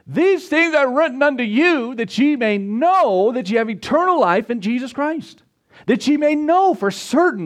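rising into a high, fired-up preaching cry; getting excited. Real, not acted. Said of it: "I'm getting excited".

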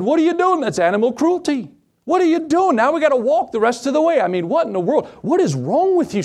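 high-pitched, outraged husband in a joke; mock panic at the wife; freaked out. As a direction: act out a husband's appalled protest, pitch shooting up. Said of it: "I freaked out".

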